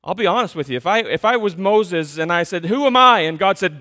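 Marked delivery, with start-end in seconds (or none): 2.7-3.2 loud, high, searching cry; loud imagined plea; pleading shout. Acted. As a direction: throw the question up to God. Moses asking God, imagined.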